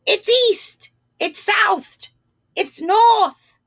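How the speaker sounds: very high squawking crow voices; cawing crows. Acted. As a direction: call it out like four noisy crows. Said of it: "they cried".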